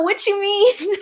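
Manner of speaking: high-pitched character impression; Little Woody. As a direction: blurt it high in a put-on character voice. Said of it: "[name]'s little Woody voice".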